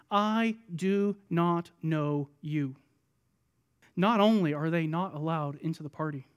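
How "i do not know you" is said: very slow, one word at a time, lower; measured, grave disowning; solemn rejection. Acted. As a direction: deliver a verdict word by word. Bridegroom's solemn verdict, quoted.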